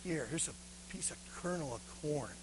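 low, very soft offering; grudging boy. Acted. Beggar boy handing the governor some corn, grudging. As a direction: give it up in a near whisper. Boy reluctantly gives more.

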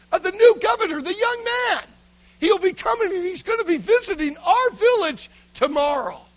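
very high, loud excited villager; spreading news. Acted. Villager telling another about the young governor's visit, excited. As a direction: gossip eagerly at a high, loud pitch. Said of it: "And the word got out".

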